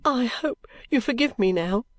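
tearful plea for forgiveness; a touch of tears in the asking; sobbing. A narrator's reading. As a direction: plead through mild sobs. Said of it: "sobbed Caddy".

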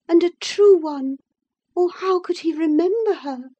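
high, loud tearful outburst; sobbing lament. Acted. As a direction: lament passionately as if in tears. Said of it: "she sobbed".

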